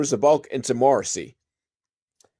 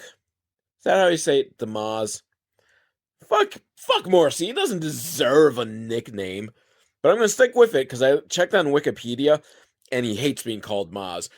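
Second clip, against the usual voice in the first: ordinary delivery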